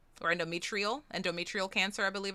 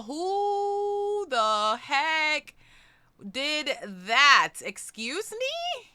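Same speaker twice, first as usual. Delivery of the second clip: drawn-out, high-pitched incredulous exclamation; flabbergasted, slowly rising squeal; stunned disbelief; 7 semitones higher, 4 dB louder, much slower than usual. Real, not acted. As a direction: stretch it out high, astonished at the donation. Shocked by a sudden donation.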